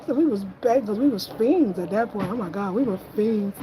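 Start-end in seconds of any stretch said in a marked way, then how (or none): none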